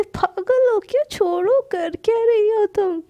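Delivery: high, loud yelp of protest; startled loud protest. Acted. Palak, tickled by Shanaya, yelps loudly in protest.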